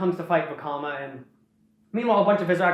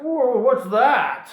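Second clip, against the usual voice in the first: high, loud, slow drawn-out whoa; a hammed-up alarmed king peering down; mock surprise, louder and higher; startled villain; 5 semitones higher, 5 dB louder, much slower than usual. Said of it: "Sidorak's like".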